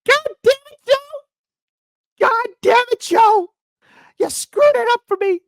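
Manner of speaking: high, loud whiny screech at Joe; mock Kamala tantrum. Kamala mocked yelling at Joe in a high whiny screech. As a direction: shriek it like a frazzled boss blaming Joe. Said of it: "She's like".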